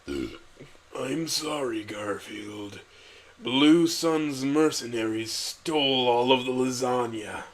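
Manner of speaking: hushed, halting worry; worried council. Acted. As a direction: break bad news nervously. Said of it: "said the council with worry".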